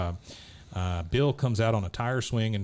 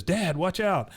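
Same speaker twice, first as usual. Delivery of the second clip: raised-pitch warning to dad; mock alarmed warning; 8 semitones higher, slower than usual. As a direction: imitate a boy calling out danger. Son warns father of dog.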